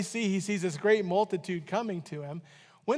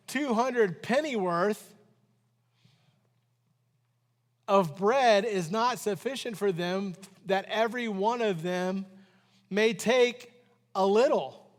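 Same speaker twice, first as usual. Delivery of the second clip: scripture reading. Acted.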